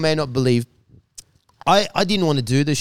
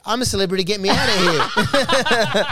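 laughing plea; 5 semitones higher, 5 dB louder than usual. Retold or imitated. Contestant laughingly begging to leave the show. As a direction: laugh through the escape phrase. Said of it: "I said it".

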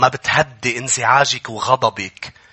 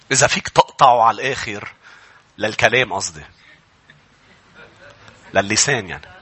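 ordinary delivery

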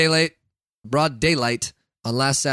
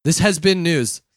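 louder formal anchor voice; news anchor sign-off; 4 dB louder, slower than usual. Shown as a demonstration. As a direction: sign off like a pro newscaster. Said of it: "Can you tell I'm a professional?".

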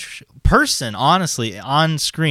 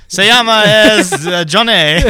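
high, loud fumbling beginner Spanish; mock Spanish student; 7 semitones higher, 7 dB louder, slower than usual. Playing a student addressing the señora, loud and hammed-up. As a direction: ham up a struggling language student. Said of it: "You should be like".

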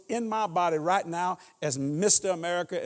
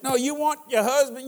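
ordinary delivery

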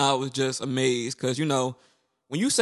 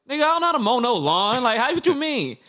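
much higher, indignant confusion; baffled protest; 10 semitones higher than usual. Retold or imitated. To his ex's mom about mowing the lawn, much higher and baffled. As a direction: act like you've never heard of a lawnmower. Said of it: "I'm like".

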